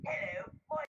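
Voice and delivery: high-pitched mock British voice; British accent. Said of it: "he's talking in a British accent".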